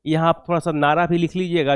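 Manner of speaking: ordinary talk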